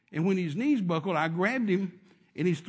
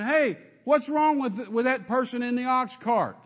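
raised voice calling across a crowd; 5 semitones higher, 4 dB louder, slower than usual. Retold or imitated. Preacher calling to the men by the cart. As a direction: call out to the men far off.

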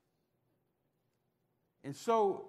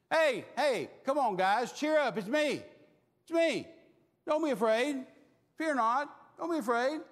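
loud, raised call across the water; calling out reassurance; 6 semitones higher, 4 dB louder than usual. Acted. Jesus calling to frightened disciples, loud and reassuring. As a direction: reach them over the waves and calm them.

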